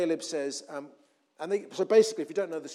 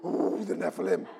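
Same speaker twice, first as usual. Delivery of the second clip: spooked crowd; 3 dB louder than usual. Acted. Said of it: "You can imagine everybody".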